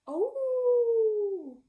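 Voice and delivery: long high loud howl; pup howling. Acted. Wolf pup calling for his mother, high howl. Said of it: "he starts to howl".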